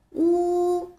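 乌 held long and high, lips rounded; 乌 drawn out level for kids to copy; model pronunciation. Shown as a demonstration. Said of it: "跟我读".

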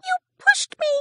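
very high, small complaining voice; tiny bug voice. A narrator's reading. Stick insect scolds the hare, very high and squeaky.

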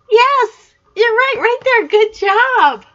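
high and loud praise; excited praise. Real, not acted. Grandma praising the child for finding the spider. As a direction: cheer them on.

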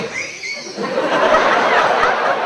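long breathy strained squeal; mimed stifled scream. Acted. Passenger in the diving plane, stifling his scream. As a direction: act out choking back a scream. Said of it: "y el gringo hacía".

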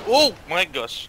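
high, loud, slow gasp of shock; drawn-out shocked exclamation; shocked exclaim. Real, not acted. Shocked that zombie has gun.

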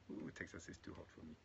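whispered, breathy complaint; whispered aside. Real, not acted. Preacher remarking on the Texas heat, whispered aside.

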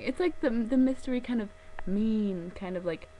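ordinary talk

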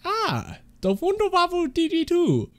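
high, soft mad-scientist German voice; a mad German scientist boasting of his gun, high and soft; Richtofen impression. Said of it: "Try to do Rick Toppin".

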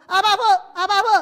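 fast, loud, laughing calls; eager calling. Shown as a demonstration. Pastor shows calling the Father eagerly, loud and fast. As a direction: rattle it off eagerly. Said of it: "然后叫你个阿爸父".

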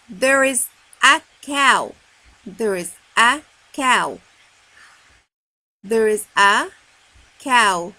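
word by word, with gaps for the class; loud, spaced-out teacher's reading; read-along model. Shown as a demonstration. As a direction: read slowly and deliberately for children to join in. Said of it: "let's read together".

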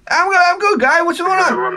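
very high mock older local; Cliff Clavin-like impression. Buddy's father answers a visitor, very high caricature voice. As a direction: exaggerate the buddy's father's greeting. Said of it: "it was like a combination of Cliff Clavin".

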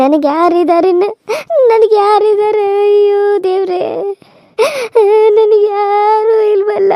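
slow, tearful wailing lament; mourning lament. Acted. Widow crying to God that she is left alone, slow lament. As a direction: drag out each cry to God.

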